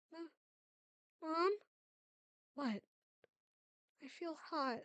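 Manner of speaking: a lightly voiced, quiet feverish girl; weak little girl. Feverish daughter calling to her mother, lightly voiced and quiet. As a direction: sound small and unwell, softly.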